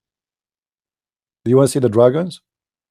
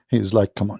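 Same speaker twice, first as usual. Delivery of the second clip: ordinary talk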